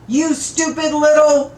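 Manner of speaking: high, loud furious roar; Ovey roars at the tattooed driver. Acted. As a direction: roar it in his face. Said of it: "Ovey roars".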